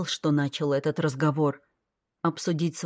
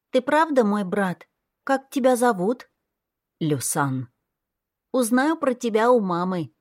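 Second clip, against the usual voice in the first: put-on high child voice asking why; curious little girl; 5 semitones higher than usual. A narrator's reading. Little girl keeps asking her brother why. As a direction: wonder aloud like a small child.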